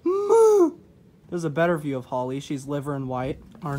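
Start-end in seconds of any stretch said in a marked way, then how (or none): 0.0-0.7 loud high goat bleat. Retold or imitated. Mocking the old bleat, loud high goat meh. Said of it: "sound more and more like an actual goat".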